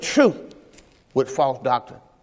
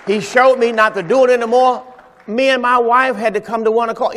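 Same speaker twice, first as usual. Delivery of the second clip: ordinary talk, nothing marked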